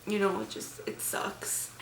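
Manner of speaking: whispered, choked-up words; crying. Real, not acted. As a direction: voice gives out in grief. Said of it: "Enough of my crying".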